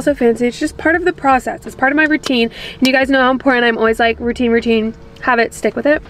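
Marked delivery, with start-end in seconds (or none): none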